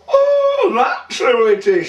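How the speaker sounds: indignant, high-voiced pub wife; scornful wife. A narrator's reading. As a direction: pitch it up high like an affronted landlady. Wife recognises the carrier, scornfully.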